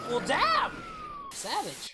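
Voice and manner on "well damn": very high, loud outburst; astonished shout. Real, not acted. Viewer reacting to a cartoon punchline, astonished shout. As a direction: blurt it out at full volume.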